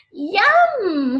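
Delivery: high, loud happy yum; delighted yum. Acted. Connor tastes his slice, delighted. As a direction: relish the bite out loud.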